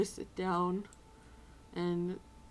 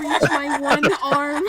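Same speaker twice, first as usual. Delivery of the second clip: laughing through tears; 4 semitones higher, 15 dB louder, slower than usual. Real, not acted. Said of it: "slightly crying".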